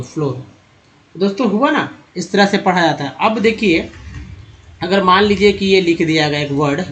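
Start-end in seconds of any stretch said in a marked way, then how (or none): 0.0-0.5 फ्लोर with a long "oh", low and soft; long o demo. Shown as a demonstration. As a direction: model फ्लोर as "flohr", dropping in pitch. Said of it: "ओ के रूप में हुआ".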